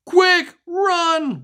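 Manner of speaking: high, loud alarm; panicked cry. Acted. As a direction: yell a frantic warning. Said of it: "one of them cried".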